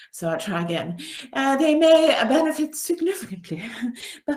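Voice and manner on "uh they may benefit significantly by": a light put-on French accent, higher and louder; mock French accent. Coach redoing her line in a jokey French accent. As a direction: ham up a French accent on the phrase. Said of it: "said in my best French accent".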